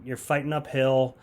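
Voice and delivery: usual speaking voice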